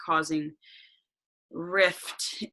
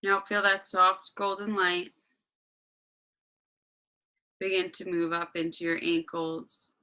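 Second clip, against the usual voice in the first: guided meditation. Acted.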